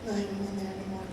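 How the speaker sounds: low-energy, resigned brush-off; quiet, fast, defeated. Retold or imitated. To her friend Emily on the phone, soft and defeated. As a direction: mutter it quickly and softly, giving up. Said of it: "And I'm like".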